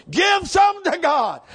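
high, loud pulpit shout; imitating a preacher's commanding cry; preacher's holler. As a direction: holler it slowly like a guest preacher. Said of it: "here's what he preached on".

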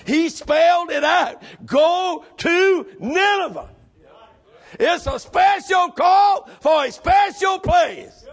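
fiery shouted preaching. Acted. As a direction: preach it with full force, slow and loud. Preaching at full force.

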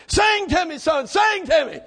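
put-on drunk father calling high; demanding drunk daddy; town drunk. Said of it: "Daddy said".

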